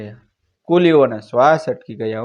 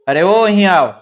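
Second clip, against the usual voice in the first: loud, higher-pitched call across the platform; loud shout; 5 semitones higher, 6 dB louder than usual. A narrator's reading. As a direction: call them over sharply and loudly. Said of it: "ઊંચા અવાજે બૂમ પાડી". (ઊંચા અવાજે બૂમ)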